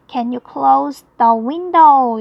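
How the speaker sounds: very slow, every word spelled out clearly; slow model reading. Shown as a demonstration. Teacher reading the model sentence very slowly. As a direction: read it slowly for students to copy. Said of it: "이렇게 표현을 해야 되겠죠".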